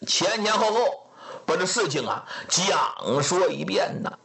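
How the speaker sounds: usual speaking voice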